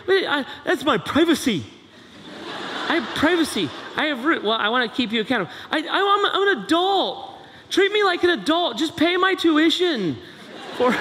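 student objecting to parent seeing grades; indignant college kid. Acted. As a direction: protest like an offended young adult.

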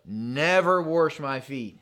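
slow, leaning on never; emphatic never. Retold or imitated. Peter telling Jesus he will never wash his feet, slow and emphatic. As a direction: dig into never as a flat refusal. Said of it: "this in the original language is emphatic".

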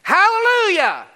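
high, loud cry of praise; full-voiced, soaring hallelujah; jubilant shout. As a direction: shout it like a roaring multitude.

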